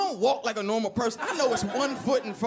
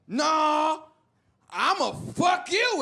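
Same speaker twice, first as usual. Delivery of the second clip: high, drawn-out copied threat; mock bravado; 6 semitones higher, much slower than usual. Teen repeating the stranger's threat back to him, high shaky-footed bravado. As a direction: puff up and stretch the threat out high.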